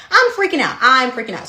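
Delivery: loud, high, dramatized panic; freaking out. Retold or imitated. Student retells fearing jail after hitting a pole, loud and freaking out. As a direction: blurt it out loudly, reliving the panic.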